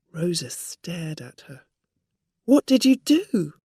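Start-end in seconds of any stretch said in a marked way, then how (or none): 2.4-3.5 surprised. A narrator's reading. Rosa surprised, asking.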